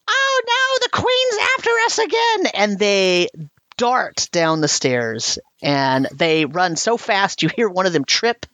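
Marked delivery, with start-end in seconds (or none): 0.1-2.5 very high, alarmed goblin squeal; panicked goblin. Acted. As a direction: goblins squealing as they flee the queen. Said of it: "you hear them say".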